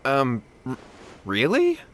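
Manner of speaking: doubtful stammer, lifting into a question; falter, unsure whether to believe it; hesitant. Acted. Brother doubting Lady Guji's recommended exorcists, hesitant. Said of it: "Is that hesitation that I detect in your voice?".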